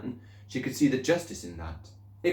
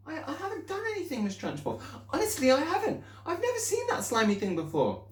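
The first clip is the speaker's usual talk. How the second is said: much higher, fast, pleading protest; pleading child. Acted. Pupil pleading innocence to the headmistress.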